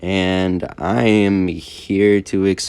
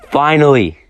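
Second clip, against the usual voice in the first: finally, shouted high and loud; emphatic shout; 8 semitones higher, 8 dB louder than usual. Shown as a demonstration. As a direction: belt out finally with relief.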